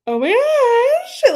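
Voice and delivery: high, loud, drawn-out squeal; over-the-top thrilled reaction; mock swooning excitement. Acts out a thrilled, not scared reaction.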